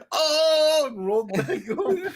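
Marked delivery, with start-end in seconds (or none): 0.1-0.8 loud, high wail of dismay; mock anguished cry. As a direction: wail as the iPad cracks. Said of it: "He went".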